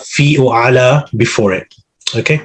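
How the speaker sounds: ordinary talk, nothing marked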